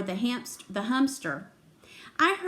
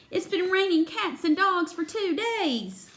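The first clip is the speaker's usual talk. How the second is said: put-on higher-pitched grumble from a boy; complaining; 7 semitones higher than usual. A narrator's reading. Hurry Up Harry comes into the room, complaining. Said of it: "came into the room complaining".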